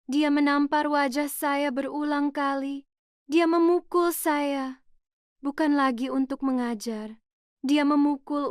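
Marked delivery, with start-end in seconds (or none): none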